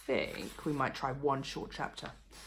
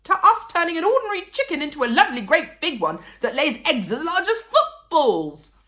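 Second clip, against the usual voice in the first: loud, high, tumbling excitement ending in a laugh; excited call; 14 semitones higher, 14 dB louder than usual. A narrator's reading. Mister Cranky hopping with excitement, gleeful loud call. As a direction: gush with glee.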